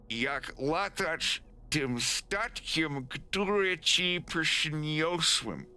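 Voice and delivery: halting read-aloud in anglicized Polish; nervous appeal. Acted. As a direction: make a careful, pleading offer.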